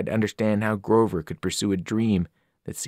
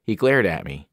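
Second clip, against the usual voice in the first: usual speaking voice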